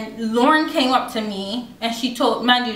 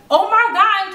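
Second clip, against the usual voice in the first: high, louder mocking "oh my God"; mock excited squeal; 10 semitones higher, 4 dB louder than usual. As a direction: mimic the gossiping girls' shriek. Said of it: "they was like".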